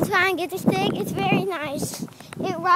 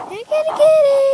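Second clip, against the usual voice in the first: high, louder sing-song kitty call; calling a cat, louder; 8 semitones higher, 6 dB louder, faster than usual. Real, not acted.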